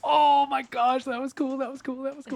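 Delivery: high, loud excited gushing. Real, not acted. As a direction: gush with delight. Player thrilled by a move.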